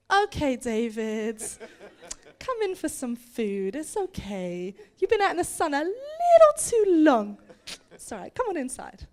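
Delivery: put-on indulge a child's tall tale; humouring parents. Doubting parents humour their child.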